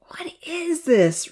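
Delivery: high, slow questioning call; puzzled call. A narrator's reading. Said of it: "Rosa called out".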